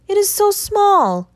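high, slow, begging plea; slow plaintive plea, pitched very high; pleading. A narrator's reading. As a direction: draw it out like a frightened boy begging. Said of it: "he pleaded".

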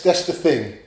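ordinary talk, nothing marked

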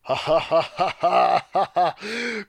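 big slow hammed-up belly laugh; hearty laugh. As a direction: laugh heartily like a jovial knight.

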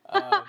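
high, loud burst of laughter. Real, not acted. Laughs loudly.

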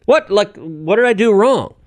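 high, baffled what, what. Retold or imitated. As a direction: act out the confused shock. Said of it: "I'm like".

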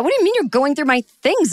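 high, quick outraged question; hammed-up affronted outburst; mock indignation. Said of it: "it was like the injustice of it".

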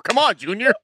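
lightly voiced gruff agent; a hint of Tommy Lee Jones; Tommy Lee Jones impression. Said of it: "Tommy Lee Jones like".

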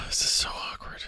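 sighing, whispered under the breath; a private whisper, not meant for the mic; awkward whisper to himself; whispered aside. Real, not acted. Aside while waiting alone.

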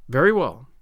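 low, clipped reply with a falling end; short and businesslike, hiding relief; stern guardian accepting an apology, crisp; crisp and curt. A narrator's reading. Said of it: "Marilla's crispness".

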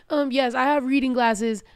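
ordinary delivery

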